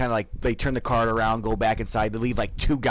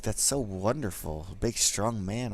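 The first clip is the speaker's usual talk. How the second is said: flirty guard; 4 semitones lower, 7 dB quieter than usual. Acted.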